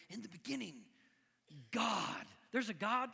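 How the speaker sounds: emphatic proclamation. Acted. Preacher quotes Genesis forcefully.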